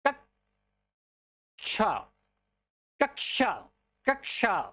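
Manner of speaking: कक्षा said slowly with a clear क्ष, ksha; drawn-out कक्षा with क्ष as kṣa; correct pronunciation. Shown as a demonstration. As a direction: pronounce कक्षा carefully as kakṣā. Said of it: "सही से उच्चारण कीजिए".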